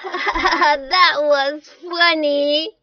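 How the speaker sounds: laugh, then crow it slowly; drawn-out gleeful mockery. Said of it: "but Sam just laughed".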